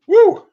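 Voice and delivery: sharp high loud whoo; high whoo of heat. Real, not acted. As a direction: blow out a high whoo from the burn. Reacting to a hot pepper.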